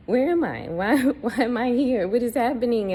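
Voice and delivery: high, loud panic with a nervous laugh; terrified screaming. Retold or imitated. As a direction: cry out lost and scared, half laughing. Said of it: "I'm terrified and I'm screaming".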